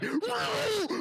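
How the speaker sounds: high-pitched wail of protest; frightened yell. Acted. Game master as someone dragged into an alley, high yell. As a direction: cry out high as if being dragged off. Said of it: "he starts getting dragged away".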